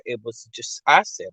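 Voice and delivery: ordinary talk, nothing marked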